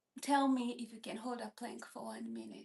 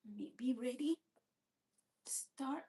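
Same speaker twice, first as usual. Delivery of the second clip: whispering; 4 dB quieter than usual. Real, not acted. Getting into plank position.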